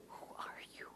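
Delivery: a clerk whispering across the counter. Retold or imitated. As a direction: lean in and whisper the question. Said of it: "And he's still whispering".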